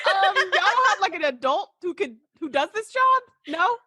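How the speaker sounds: high, giggly mock question; laughing disbelief. Mocking the crew's choice of babysitter, laughing. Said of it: "I was like".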